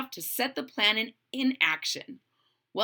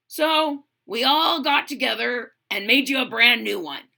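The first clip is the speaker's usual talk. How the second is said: louder, hearty put-on voice; big-hearted woman voice; 5 dB louder than usual. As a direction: speak with a big warm heart. Large Marge speaks to Smudge.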